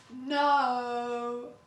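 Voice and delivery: a sudden loud flat refusal; loud firm no. Real, not acted. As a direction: shut it down hard and loud. Flat refusal after mocked message.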